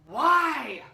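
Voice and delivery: loud, drawn-out mock wail; exasperated wail. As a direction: cry out in hammed-up despair. Acted cry of frustration.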